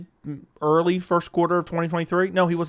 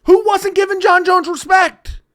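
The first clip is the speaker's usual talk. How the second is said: shouting; 12 semitones higher, 6 dB louder than usual. Real, not acted. Opening outburst.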